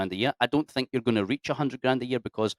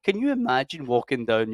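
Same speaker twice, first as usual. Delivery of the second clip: ordinary talk, nothing marked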